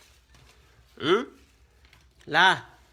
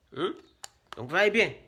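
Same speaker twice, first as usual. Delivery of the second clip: normal talking voice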